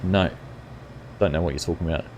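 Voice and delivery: normal talking voice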